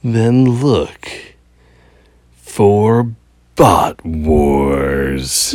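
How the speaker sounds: slow breathy ASMR delivery. Acted. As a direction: murmur it like an ASMR YouTuber. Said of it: "You just sound like an A S M R YouTuber".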